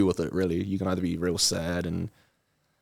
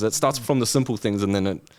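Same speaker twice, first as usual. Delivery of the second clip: ordinary talk, nothing marked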